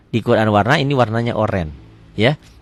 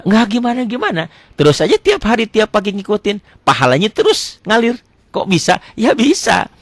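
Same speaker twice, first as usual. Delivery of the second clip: higher, bright answer to himself; a touch of cheerful; 9 semitones higher than usual. Acted. Teacher answers his own question, lightly upbeat. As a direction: lift it as if the answer is obvious.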